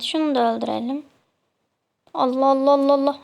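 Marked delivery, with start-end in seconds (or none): 2.1-3.2 exasperated rapid Allahs. Real, not acted. Frustrated during game kill.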